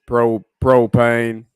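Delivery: slow, loud, low Texan drawl on propane; drawn-out Hank Hill propane. Retold or imitated. Gamer imitating Hank Hill, slow Texan drawl.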